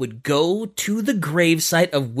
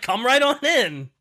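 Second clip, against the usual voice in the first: higher, cheery invitation; welcoming God; 8 semitones higher than usual. Acted. God inviting Glyndor through the gates, lightly voiced and cheery. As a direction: wave him in like a friendly doorman.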